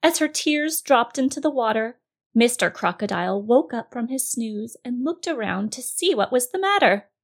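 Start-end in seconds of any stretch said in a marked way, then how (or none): none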